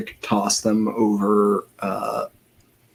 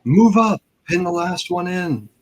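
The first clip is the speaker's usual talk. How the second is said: high, half-restrained in-character shout; mock battle shout, easing off in volume; shout from behind; 8 semitones higher than usual. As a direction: call urgent orders up to an ally, pitched high. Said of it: "I shout from behind".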